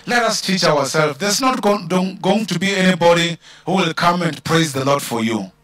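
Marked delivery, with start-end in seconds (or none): none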